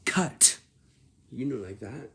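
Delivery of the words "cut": sharp, high, loud "cut."; energetic cut. Shown as a demonstration. Director calling a firm, loud "cut.". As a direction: bark "cut." like you mean it. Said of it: "You sound like this".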